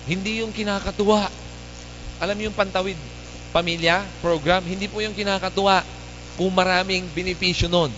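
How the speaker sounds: usual speaking voice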